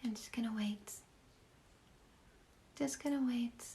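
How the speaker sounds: hushed aside under the breath; whisper to self. Real, not acted. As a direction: murmur it to yourself while waiting. Talks to herself waiting for questions.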